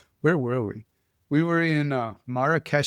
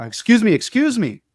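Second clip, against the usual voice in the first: much higher, louder call from behind; 10 semitones higher, 8 dB louder than usual. Retold or imitated. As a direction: shout after someone walking away. Waiter calling after him.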